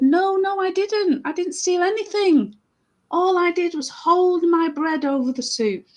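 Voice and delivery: high, urgent acted denial; protesting innocence. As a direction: protest like a wrongly accused man. Accused man denies stealing.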